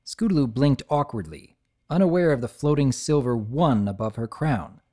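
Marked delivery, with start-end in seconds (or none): none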